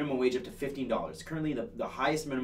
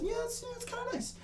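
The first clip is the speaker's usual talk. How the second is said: much higher, softer sing-song "kinda nice"; sarcastic high squeak of approval; mock approval; 7 dB quieter than usual. Host mockingly praising the party platform.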